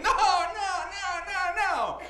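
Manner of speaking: high-pitched wailing first grader; hammed-up tearful refusals; mock crying child. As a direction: imitate a little kid bawling in protest. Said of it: "immediately starts crying".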